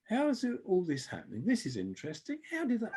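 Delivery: high-pitched marvelling to oneself; amazed wondering. Retold or imitated. Onlooker watching events unfold in government meetings, amazed wondering.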